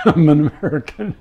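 mock Swedish accent, laughing. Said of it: "with this severe Swedish accent".

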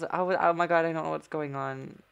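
usual speaking voice

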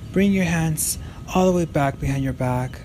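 ordinary delivery

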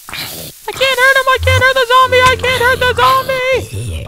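high, loud panicked squeal; sudden frantic high-pitched wail; panicked shriek. Real, not acted. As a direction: shriek it in real gaming panic. Zombie invincible, player panicking.

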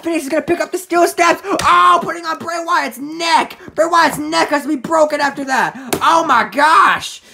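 excited wrestling commentary. Acted. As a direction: call the sudden turnaround as it happens. Calls the attack.

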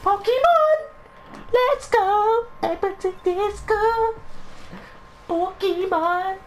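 very high falsetto cheer; happy squealing. Pretend. Gamer in a happy mood mid-match, happy squealing. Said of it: "I'm in a happy mood".

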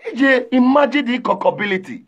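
usual speaking voice

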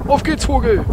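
hoch und laut losrufen; aufgeregter Ruf. Real, not acted. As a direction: voller Jagdeifer rufen. Jagt im Spiel einen Vogel. (aufgeregter Ruf)

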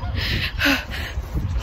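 quick frightened gasp; breathy gasp. Real, not acted. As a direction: catch the breath in alarm. Geese hissing nearby.

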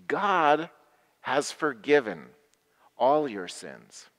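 usual speaking voice

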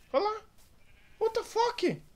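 frantic high yelling; panicked shouting. Real, not acted. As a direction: scream in panic at the screen. Zombies attacking his village.

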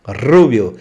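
rubio with a trilled rr; teacher stressing the vibrating R; strong rolled R. Shown as a demonstration. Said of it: "Pronúncia forte, vibrante".

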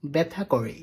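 nachäffen, tief und leiser; äfft ihn spöttisch nach; mocking echo. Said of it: "তুই কি আমাকে ভেঙ্গিয়ে কথা বললি".

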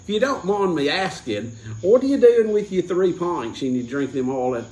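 high, hammed-up Aussie accent; Australian bartender. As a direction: ask like a nosy Australian barman. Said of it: "the bartender was Australian".

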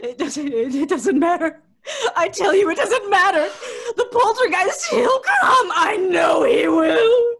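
crying, laughing, rising to a shout; sobbing terrified rant; hysterical fear. Acted. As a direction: panic climbing into a wail. Terrified girl raving about poltergeist.

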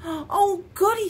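high, gleeful little burst after a gasp; gasp, then exclaim it high and loud; delighted. A narrator's reading. Bear learns what the moon wants, delighted.

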